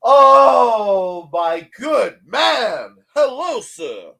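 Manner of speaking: loud, slow, theatrical hail; hammed-up grand greeting. Welcoming a viewer to the stream, mock pompous and loud. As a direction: boom it out like a pompous gent.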